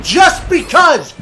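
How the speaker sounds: high, slow shouted echo; outraged disbelief. Acted. Caller throwing the agent's answer back, outraged disbelief. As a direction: shout it back, drawn out and incredulous.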